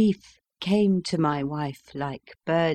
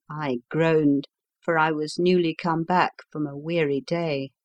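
plain reading voice

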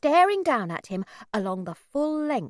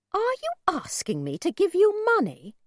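suspicious; 10 semitones higher, 4 dB louder than usual. A narrator's reading. Suspicious mother confronts stranger.

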